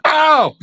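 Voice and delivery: high sharp "ow" of pain; stubbed toe yelp. Acted. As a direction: yelp like you just stubbed your toe. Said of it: "I just stubbed my toe".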